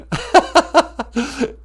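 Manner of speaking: high, loud laughing tease; a listener laughing at Papa Wagosh's joke. Real, not acted. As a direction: laugh and call him a clown.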